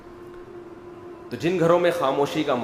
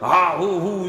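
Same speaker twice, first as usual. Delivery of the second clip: hammed-up bellow like a warlord storming in; mock tyrant roar; 3 semitones higher, slower than usual. Father storming home, a mock warlord roar. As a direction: play a conqueror barging into the house. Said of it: "چنگیز خان اور Hulagu Khan کی طرح".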